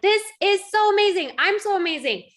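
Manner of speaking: high, bright-eyed gushing, mocking herself; smug self-praise. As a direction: gush like a proud parent. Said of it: "And I was like".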